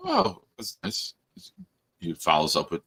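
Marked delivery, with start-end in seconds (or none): 0.1-1.1 high, slow, soft put-on voice; mock sweet. Dad retells his fake-polite reply, high and slow. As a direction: coo it falsely sweet.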